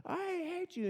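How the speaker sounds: squeaky half-voiced imitation of a screaming child; screaming little boy. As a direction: imitate a small boy's tantrum, held back. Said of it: "this little boy was screaming his head off".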